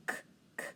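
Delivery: k as a voiceless click, no vowel; isolated k sound. Shown as a demonstration. Teacher sounding out sickness, short voiceless k. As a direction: say "k" twice as a bare consonant. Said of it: "That k sound".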